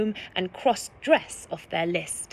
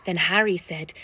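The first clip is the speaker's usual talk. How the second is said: usual speaking voice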